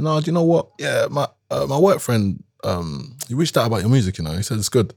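lightly voiced dad passing on praise; imitating dad. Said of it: "He was like".

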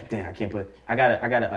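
ordinary talk

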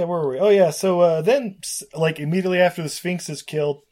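ordinary delivery

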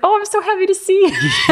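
gushing in a very high, laughing voice; giddy laughing. Retold or imitated. Concussed woman in her friend's tight hug, giddy laughing. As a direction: act out dazed, over-happy delight. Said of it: "I was just laughing".

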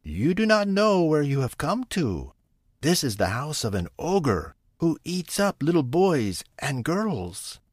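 a frightened kind woman warning; worried ogre's wife. A narrator's reading. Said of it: "said the woman".

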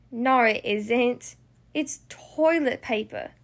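slow, deflated delivery; let down. Acted. Bluey finding toilet paper instead of chocolate, disappointed. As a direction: drop the excitement and slow right down. Hope turns to letdown.

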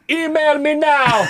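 high, loud hammed-up Jamaican accent. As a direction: squeal it in a mock Jamaican accent. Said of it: "hideous Jamaican accent".

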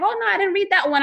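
high, quick sheepish admission; sheepish self-quote. Retold or imitated. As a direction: brush it off fast, a bit embarrassed. Retelling her own sheepish reply.

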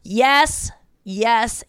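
ordinary talk, nothing marked

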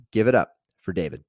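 normal talking voice

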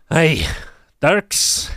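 gruff-ish dwarf greeting, not far from own voice; Berdruk's voice. Acted. GM as the dwarf greeting Dirk, mildly voiced. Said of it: "And he just goes".

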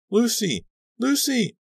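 very high frantic cry; piercing call; shrieking. A narrator's reading. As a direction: scream her name down to her. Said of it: "I was shrieking her name".

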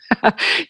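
short amused chuckle; laugh. Real, not acted. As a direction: let out a brief real laugh. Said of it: "I laugh about it".

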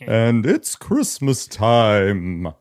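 very slow, drawn-out Santa. Acted. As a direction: stretch it out like an old Santa. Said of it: "I can't do a friendly Santa voice".

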